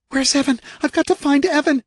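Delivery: high, panicked plea; desperate searching cry; frantic woman. A narrator's reading. Kay voiced, frantic.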